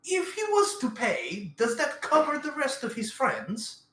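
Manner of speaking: high, anxious third-person voice; nervous high character voice; Guillermo voice. Acted. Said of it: "It's like".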